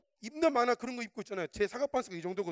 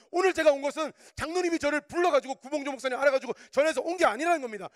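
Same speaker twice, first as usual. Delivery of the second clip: loud, raised preacher's delivery; impassioned preaching; 6 semitones higher, 6 dB louder than usual. Real, not acted. Preacher urging the congregation to know the Lord's time, impassioned. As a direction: preach it forcefully in driving phrases.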